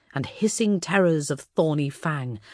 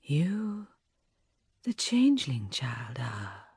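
echoing ethereal energy being; lilting spirit voice; sing-song voice; 5 dB quieter, slower than usual. A narrator's reading. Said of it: "in a sing-song voice".

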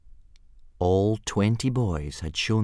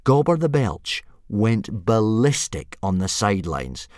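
ordinary delivery

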